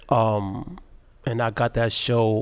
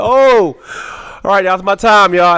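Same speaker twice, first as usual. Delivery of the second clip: high, loud, theatrical giving up; mock resignation; 8 semitones higher, 11 dB louder than usual. Quoting himself leaving the stage, loud mock resignation. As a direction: toss it off like a defeated exit line. Acting his exit after bombing.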